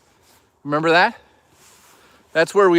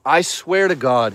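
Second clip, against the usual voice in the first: usual speaking voice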